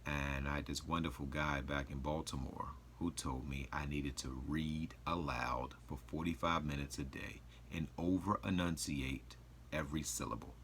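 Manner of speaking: ordinary talk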